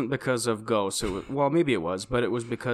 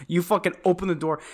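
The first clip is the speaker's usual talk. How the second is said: normal talking voice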